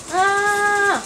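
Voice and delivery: high, loud yell of pain. Real, not acted. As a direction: cry out as the hit lands. Hurt in the game.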